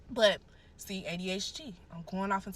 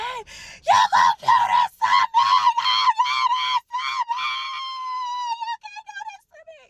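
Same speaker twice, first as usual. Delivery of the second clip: screaming; 13 dB louder than usual. Retold or imitated. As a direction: scream it at him, fading at the end. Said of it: "and I'm screaming, screaming his name".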